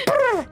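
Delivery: high trilled flutter noise; pigeon taking off. Acted. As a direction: imitate a startled bird's wings with a rolled brr. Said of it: "This pigeon goes".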